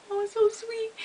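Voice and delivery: high, soft retold coo; touched, sweet little voice; gushing. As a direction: coo it as if melting at the sight. Imitating own touched reaction.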